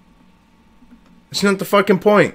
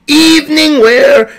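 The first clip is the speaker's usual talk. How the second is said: high, loud, drawn-out bad Russian accent; hammed-up Russian announcer calling out slowly; Soviet fashion show announcer; 11 semitones higher, 6 dB louder, slower than usual. Vlogger imitating the old Wendy's Soviet fashion show, hammed-up announcer. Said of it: "the Soviet fashion show and be like".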